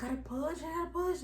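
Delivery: rising, almost crying plea to push; pleading with a cracking, climbing voice; near tears. Retold or imitated. Mother in labor begging the nurse, almost crying. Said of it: "I started almost crying".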